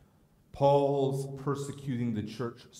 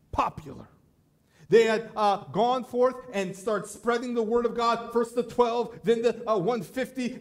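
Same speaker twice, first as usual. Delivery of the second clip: louder, higher preaching voice; preaching at full force; 9 semitones higher, 5 dB louder than usual. Acted. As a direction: preach it out to the congregation.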